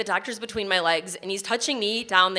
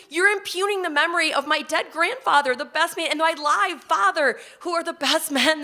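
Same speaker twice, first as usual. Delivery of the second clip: higher, louder indignation; indignant outburst; 7 semitones higher, 4 dB louder than usual. Real, not acted. Daughter defending her dead grandfather, pitched high and loud. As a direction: defend them, outraged.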